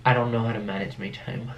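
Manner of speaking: ordinary talk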